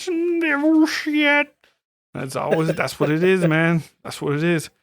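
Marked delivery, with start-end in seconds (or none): 0.0-1.5 high, slow eerie drawl; slow spooky devil voice. Retold or imitated. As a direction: stretch it out like a horror movie. Echoing the devil line.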